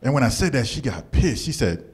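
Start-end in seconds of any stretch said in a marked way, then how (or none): none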